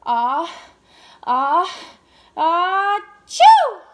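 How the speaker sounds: building sneeze that explodes loud on chew; hammed-up sneeze for the children; big acted sneeze. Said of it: "And Andrew went".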